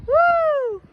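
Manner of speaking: high, loud "woo"; high cheering whoop; excited whoop. Real, not acted. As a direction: whoop it out in celebration. Cheering at fireworks show.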